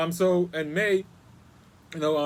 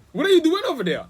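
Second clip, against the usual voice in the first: imitating the neighbor, much higher and louder; raised-voice, high-pitched imitation of a nosy challenge; mock confrontational challenge; 14 semitones higher, 10 dB louder than usual. Neighbor challenges a stranger strolling in the backyard, mock confrontational.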